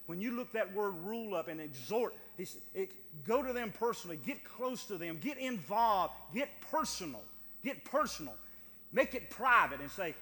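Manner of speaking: loud, driving pulpit voice; full-force preaching. Acted. Preacher urging the church to confront others personally, loud and driving. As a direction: hammer it home to the congregation.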